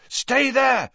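high-pitched shout over the wind; urgent shout. A narrator's reading. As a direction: call out to the others over a howling wind. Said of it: "he shouted at the others over the howling wind".

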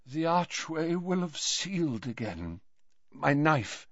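put-on soft, failing old man; weak. A narrator's reading. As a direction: murmur with little breath. Weakened Dumbledore gives instructions.

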